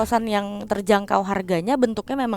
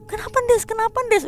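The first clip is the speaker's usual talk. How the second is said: much higher, urgent repeated question; anxious pestering; 13 semitones higher, 3 dB louder than usual. Retold or imitated. Roommate pestering Des about her face, high and urgent. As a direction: press her for an answer, worried. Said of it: "dia udah marah-marah".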